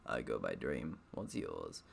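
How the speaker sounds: low and soft, lifeless deadpan; corpse voice. Acted. Dream introducing himself to the reader in a low corpse voice. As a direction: sound like a talking corpse, low and quiet. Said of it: "giving him a corpse voice".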